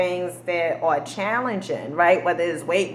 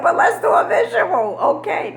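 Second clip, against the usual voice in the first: excited urging; 13 semitones higher, 3 dB louder than usual. Retold or imitated. Urging rep to do visual.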